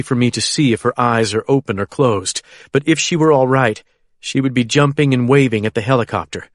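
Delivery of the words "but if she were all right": ordinary delivery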